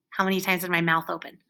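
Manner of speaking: usual speaking voice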